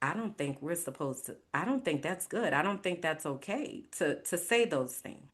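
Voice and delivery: softly, hesitantly voiced quote, stumbling over restarts; hesitant quiet objection. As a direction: quietly replay the timid protest, restarting each sentence.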